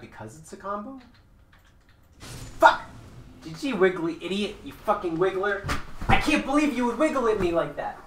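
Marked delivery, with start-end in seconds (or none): 2.2-8.0 raised, higher-pitched fake outrage; mock anger. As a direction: rant as if furious, for a joke. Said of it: "I'm not actually mad at you".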